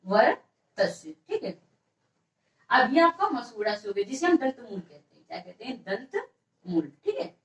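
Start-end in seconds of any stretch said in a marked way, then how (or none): 0.0-1.1 वर्तस slowly, in two separated parts; slow, broken up. Shown as a demonstration. Teacher repeats term for note-takers, slow and broken up. 5.8-6.9 दंत मूल softly, each word apart; slow, separated. Shown as a demonstration. Teacher answers own question for students, slow and separated.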